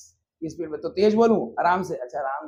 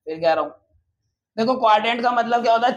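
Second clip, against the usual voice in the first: ordinary delivery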